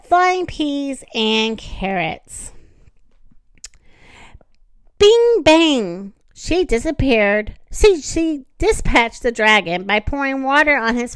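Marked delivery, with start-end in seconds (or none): 5.0-6.1 dramatic sound words. A narrator's reading. Sound words for Mom vanishing.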